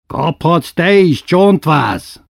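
high, loud, slow threatening shout; angry roar. Acted. Coachman threatening Memike, loud angry shout. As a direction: bellow the threat at the girl. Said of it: "ordított ellilut fejjel Kakamatyi".

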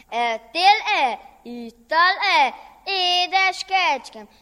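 slow coaxing question. Acted. Master asks the goat.

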